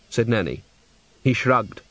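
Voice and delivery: plain reading voice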